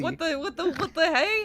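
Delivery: rising startled cry, pitch climbing. Real, not acted. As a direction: caught off guard, voice jumping up. Startled by something on screen.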